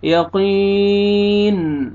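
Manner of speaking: slow chanted "यक़ीन"; heavy qaf, madd. Shown as a demonstration. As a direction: stretch the ee of "यक़ीन" after a heavy Q. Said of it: "क़ाफ़ को मोटा करके पढ़ना है".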